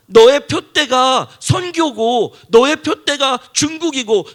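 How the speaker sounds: loud, high, rising sermon build; preaching at full force. Acted. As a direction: drive the point home to the congregation. Preaching climax.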